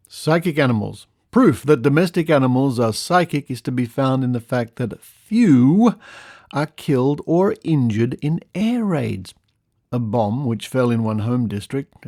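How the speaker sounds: measured, emphatic storytelling read; lively, deliberate narration; article read-aloud. Acted. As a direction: read out a curious old news report. Reads an old article aloud.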